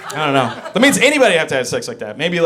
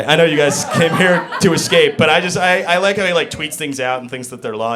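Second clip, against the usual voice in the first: usual speaking voice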